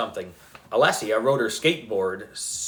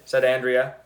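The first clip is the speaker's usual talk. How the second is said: usual speaking voice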